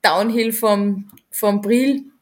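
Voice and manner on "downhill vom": ordinary talk, nothing marked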